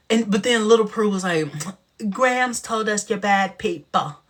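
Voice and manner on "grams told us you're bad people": put-on slow, prissy old-uncle voice; Uncle Arthur voice. Little girl scolds in a slow Uncle Arthur voice. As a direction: drag it out like a fussy uncle. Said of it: "making this child sound like Uncle Arthur".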